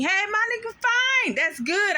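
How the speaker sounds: sarcastic high-pitched brush-off; mock dismissal. To the man who robbed her, a sarcastic high brush-off. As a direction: toss him off sarcastically, voice leaping up.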